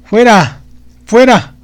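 high, loud cry of rejection; desperate cry. Acted. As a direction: shout it away in terror. Magician drives god away.

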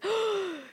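sharp acted intake of breath; excited gasp. Friend calls with astonishing news, excited gasp.

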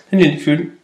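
ordinary delivery

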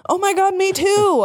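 high, gushing fangirl delight; happy reply. A narrator's reading. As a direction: squeal it happily, stretching the last word. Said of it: "I replied happily".

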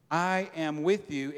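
each word slow and weighted; slow emphasis. Acted. Pastor pressing the promise, slow. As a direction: lean on I am with you slowly. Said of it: "repeated slow".

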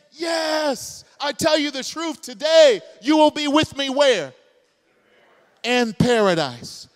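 high, loud, joyful proclamation; Jesus's triumphant answer. Retold or imitated. Pastor voicing Jesus answering the criminal, shouting joyfully. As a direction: announce the promise like good news. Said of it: "What did he say?".

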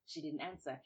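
ordinary delivery, nothing marked

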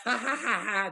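high staccato "ha-ha-ha" laugh; imitated movie laugh. Showing the laugh he does in the movie, imitated high laugh. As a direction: do a high, put-on laugh in bursts. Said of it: "you know, like that laugh".

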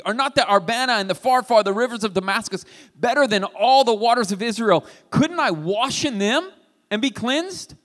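indignant reread. Shown as a demonstration. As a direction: spit out the river names in offended pride. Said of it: "I was thinking it should be".

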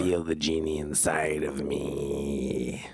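low, slow spooky genie; a hint of a demon genie. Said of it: "Stop using that voice".

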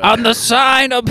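very high, slow caricature voice; Gilbert Gottfried. Acted. Friend tells bar joke as an impression, high comedian caricature. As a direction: stretch each word in a piercingly high pitch. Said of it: "Gilbert Godfrey".

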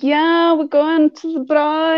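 Joburg accent. Shown as a demonstration. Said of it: "With a Joburg one".

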